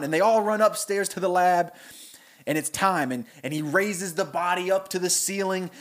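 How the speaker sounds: ordinary delivery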